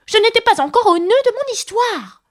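loud, very high indignant outburst; a furious mouse protesting at full pitch. A narrator's reading. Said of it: "d'un ton furieux". (ton furieux, indigné)

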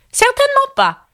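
very high, imperious refusal; peremptory. A narrator's reading. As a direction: cut it off like an offended queen. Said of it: "d'un ton péremptoire". (ton péremptoire, voix très aiguë)

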